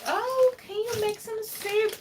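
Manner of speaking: high, slow sing-song mom asking a favour; imitating mom. Quoting her mom asking her to cook soup, high and sweet. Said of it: "She was like".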